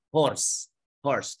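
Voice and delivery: "horse" with a clear breathy h; pron of horse. Shown as a demonstration. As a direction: sound the h in "horse". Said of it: "Kalau dia pengucapannya h, h". ("horse" dengan h diucapkan jelas)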